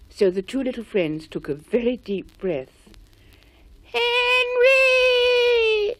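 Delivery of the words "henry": high, loud sung call. A narrator's reading. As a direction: call across the woods. Friends call out for Henry.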